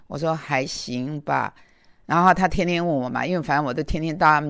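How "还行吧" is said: slow, low-spirited, half-hearted reply; 垂头丧气. Retold or imitated. Answering the concerned elder, downcast and slow. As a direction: drag it out softly, a bit dejected. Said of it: "很垂头丧气的".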